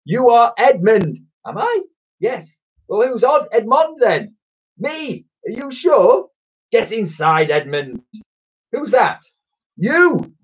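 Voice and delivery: very high, excitable bickering voices; hammed-up exasperated double act; squabbling comic twins. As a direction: argue absurdly with your twin, high-pitched.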